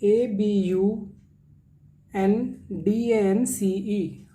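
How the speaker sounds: letters spelt slowly; slow letter-by-letter spelling. Shown as a demonstration. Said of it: "spelling the word letter by letter".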